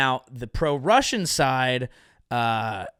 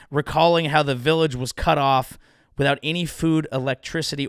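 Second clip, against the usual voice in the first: normal talking voice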